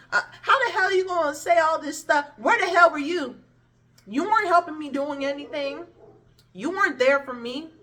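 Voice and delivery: retold outburst at the godmother; angry retold rant. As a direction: throw the blame at her. Confronting godmom on the phone.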